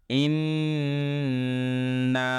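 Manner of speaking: ইন্না with the nun held double and long; doubled nun. Shown as a demonstration. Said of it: "দুইবার করে কথা বলতে হয়".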